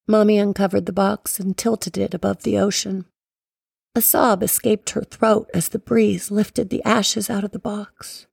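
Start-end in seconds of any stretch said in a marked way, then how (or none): none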